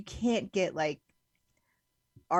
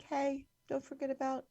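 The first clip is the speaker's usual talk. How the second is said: higher, soft little reminder voice; gentle mock reminder; 6 semitones higher, 6 dB quieter than usual. Vegan reminding people before lunch meetings, gently. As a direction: nudge them softly, lightly acted.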